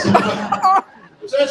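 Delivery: loud, slow, laughing; nervous laugh. Real, not acted. Handler laughing off a close call with the gator. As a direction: laugh off the scare. After nearly being bitten.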